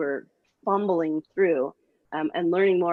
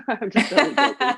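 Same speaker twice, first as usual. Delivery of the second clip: laughing through the words, pitched up; 7 semitones higher, 5 dB louder than usual. Real, not acted. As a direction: giggle the line out as a joke. Laughs after joking about kids.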